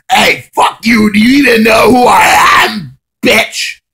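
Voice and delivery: high, slow, drawn-out furious yell; all-caps angry shout. Retold or imitated. Re-enacting his nasty message to her, angry shout. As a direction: bellow it, stretching every word. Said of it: "all caps a fucking nasty-ass message".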